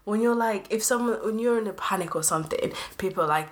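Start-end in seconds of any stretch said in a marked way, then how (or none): none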